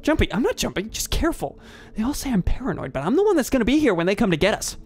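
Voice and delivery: a nervous wreck insisting he's right; paranoid man. Acted. Paranoid NPC Greg voiced.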